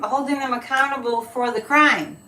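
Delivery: ordinary talk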